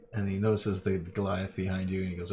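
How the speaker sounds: ordinary talk, nothing marked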